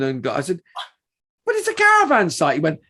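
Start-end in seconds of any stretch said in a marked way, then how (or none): 1.5-2.5 incredulous. Retold or imitated. Disbelief at bouncer's warning.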